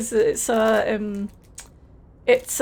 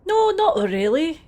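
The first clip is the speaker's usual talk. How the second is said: hammed-up Scottish dockworker, a touch higher and cheerful; put-on Scottish accent, friendly and a bit overdone; terrible Scottish accent; 5 semitones higher, 3 dB louder than usual. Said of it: "please enjoy my terrible Scottish accent".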